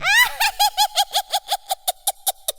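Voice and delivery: monkey howling with laughter; shrieking monkey laugh. A narrator's reading. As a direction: laugh wildly up high.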